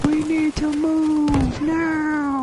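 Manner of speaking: high, very slow drawn-out yell; drawn-out call. Pretend. Player calls the team to move, long drawn-out holler. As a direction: stretch every word into a long high holler. Urgent order called out in game.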